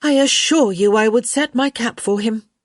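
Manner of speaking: high, loud, exasperated assurance; beleaguered. A narrator's reading. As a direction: insist as if long pestered. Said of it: "with a beleaguered sigh".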